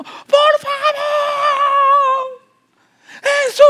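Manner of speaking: very high, loud cry of please, Jesus; shouted plea. Shown as a demonstration. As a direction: scream out for Jesus to hear. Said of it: "Ella está gritando".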